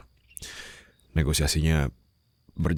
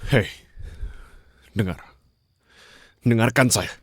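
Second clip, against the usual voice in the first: urgent command; 6 semitones higher than usual. Acted. Urging grieving captive to listen.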